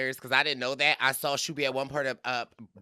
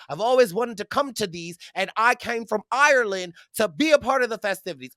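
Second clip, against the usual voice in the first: higher, quick mock Irish lilt; imitated Irish accent; 6 semitones higher, faster than usual. Irishman explains his trip to New York, imitated Irish accent. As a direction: put on an eager Irish accent. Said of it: "He said".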